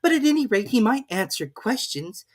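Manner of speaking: put-on high, pleading girl's voice; a polite girl asking; young Alice. A narrator's reading. Alice figure voice.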